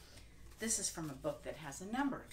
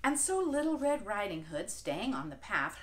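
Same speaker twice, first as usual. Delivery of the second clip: ordinary delivery, nothing marked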